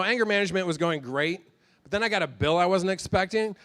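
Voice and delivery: ordinary talk, nothing marked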